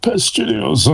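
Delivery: high retold quip. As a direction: quote it high and quick. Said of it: "Question said".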